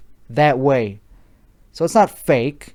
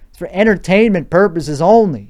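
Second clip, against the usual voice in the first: Chicago accent; 3 semitones higher than usual. Shown as a demonstration. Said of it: "the Chicago accent goes on full display".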